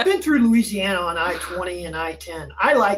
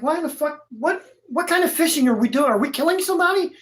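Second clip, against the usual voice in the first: alarmed disbelief; 7 semitones higher than usual. Retold or imitated. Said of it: "the guy's like".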